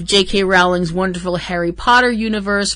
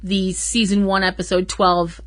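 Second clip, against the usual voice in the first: ordinary talk, nothing marked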